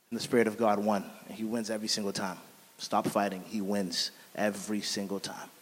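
low, soft, assured testimony; hushed conviction. Real, not acted. Preacher telling listeners the Spirit always wins, hushed conviction. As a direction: drop low and quiet, letting each phrase land. Quiet testimony after a laugh.

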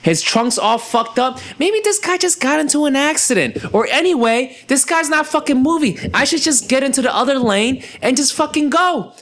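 high, whiny pretend inner voice of a driver; mock driver thinking. Mocking the drivers behind his wrecked car: high, whiny and sarcastic. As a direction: voice what the oblivious drivers should have thought.

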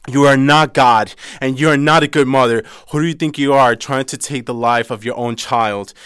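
loud, high outraged yelling; furious confrontation. Retold or imitated. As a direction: tear into the mother in fury. Sister confronting the mother.

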